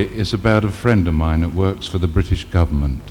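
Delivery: usual speaking voice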